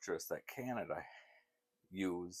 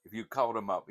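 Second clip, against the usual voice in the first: ordinary talk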